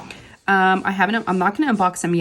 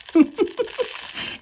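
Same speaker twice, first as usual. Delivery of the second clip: breathy laugh. Real, not acted. Realizing the double booking.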